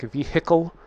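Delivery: "vehicle" with heavy stress on "hickle", rising; Wisconsin vehicle. Shown as a demonstration. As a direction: lean hard on the "hickle" in "vehicle". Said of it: "way too much emphasis on the hickle".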